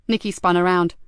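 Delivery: ordinary delivery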